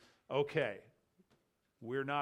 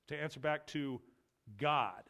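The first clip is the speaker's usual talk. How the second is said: "God" set apart after a pause, stressed; pause, then land heavily on "God"; double emphasis. Shown as a demonstration. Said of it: "with another double emphasis".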